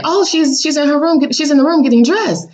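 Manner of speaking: high mock voice of the father; mimicking his dad. Voicing the ex's father telling the parents where she is.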